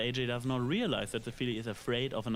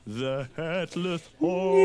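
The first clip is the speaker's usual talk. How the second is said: dramatic spooky announcement; 5 semitones higher, 9 dB louder, much slower than usual. Acted.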